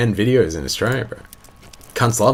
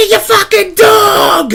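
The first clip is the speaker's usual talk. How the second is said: slow drawn-out yell, much higher and louder; retold mirror shout; 5 dB louder, much slower than usual. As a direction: bellow it slowly at your own reflection. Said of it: "literally out of nowhere was like".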